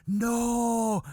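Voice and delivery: high, drawn-out mock gasp of no; shocked disbelief. Aunties hear a man was crucified, mock shocked. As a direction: imitate women aghast at gossip.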